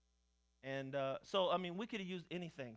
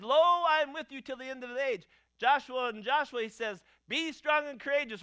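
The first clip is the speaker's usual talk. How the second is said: louder, higher, ringing sermon delivery; fervent preaching; 5 semitones higher, 8 dB louder than usual. Acted. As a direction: lift the congregation up with every line. Preaching at full force.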